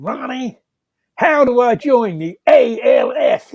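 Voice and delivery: loud, slow, high call across the room. Retold or imitated. Stockbroker asking Ronnie in a crowded cafe, loudly. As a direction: announce it loudly for the whole cafe to hear. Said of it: "in a very loud voice".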